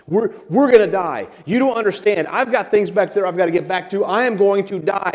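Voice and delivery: high-pitched acted panic; panic attack. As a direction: play it as a castaway losing his head. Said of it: "the guy's just throwing a panic attack".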